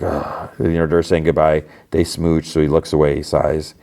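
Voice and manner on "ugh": a soft, low groan of dismay; disgusted ugh. Retold or imitated. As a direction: sink into a quiet ugh. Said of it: "and he's like".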